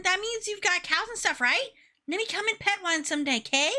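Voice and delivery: put-on high, chirpy child voice. Child greets the new farmer, asks to pet cows, child voice. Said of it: "how to do child voice again".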